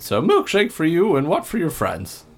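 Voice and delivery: lightly voiced bartender Jim. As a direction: play the tired bartender, lightly. GM voicing Jim Tambor.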